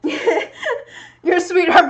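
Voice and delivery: high, loud, very slow laughing coo; laughing pet talk. Real, not acted. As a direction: melt over the cat while laughing. Talking to her cat.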